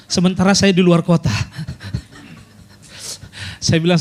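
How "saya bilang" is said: ordinary talk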